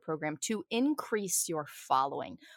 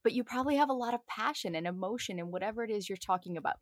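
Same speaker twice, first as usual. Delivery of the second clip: ordinary delivery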